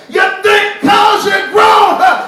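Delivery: high, loud, slow hellfire preaching shout; roaring brimstone sermon with long drawn words; brimstone preacher grandmother. Retold or imitated. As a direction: preach it like a furious grandmother at the pulpit. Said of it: "went off on him like a brimstone preacher".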